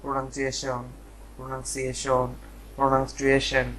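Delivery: প্রোনাউন্সিয়েশন in varying wrong forms, "pronansieshon, pronangstrieshen"; common mispronunciations. Shown as a demonstration. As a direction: list the mistaken ways of saying প্রোনাউন্সিয়েশন, evenly and softly. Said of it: "আসলে আমরা বলে থাকি".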